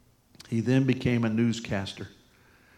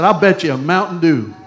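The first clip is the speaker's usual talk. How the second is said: higher, much louder retold challenge; loud wager; 5 semitones higher, 12 dB louder than usual. Preacher taking up the guest's bet, loud challenge. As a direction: throw the bet down loudly, hamming it up.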